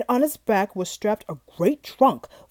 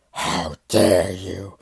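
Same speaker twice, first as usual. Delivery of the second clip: deep, slow monstrous growl; beast growl; 3 dB louder, much slower than usual. Acted. Furious Beast confronting the rose thief, deep slow growl. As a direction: drag the words out from deep in the chest. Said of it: "it growled".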